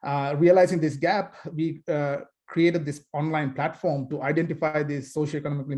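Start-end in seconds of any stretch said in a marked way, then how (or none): none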